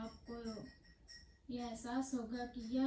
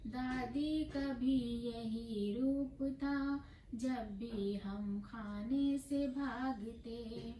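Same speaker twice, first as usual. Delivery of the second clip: lilting, half-sung verse with drawn-out endings; poem recitation; 4 semitones higher, 4 dB louder, much slower than usual. Acted. Poet reciting about beloved grandmothers, melodic recitation. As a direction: chant it tunefully, stretching the vowels.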